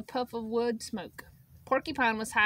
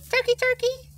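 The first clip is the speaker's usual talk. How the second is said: sugary, much higher sing-song call; sweetest voice; 13 semitones higher, 6 dB louder than usual. A narrator's reading. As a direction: coax him sweetly. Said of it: "in the sweetest of voices".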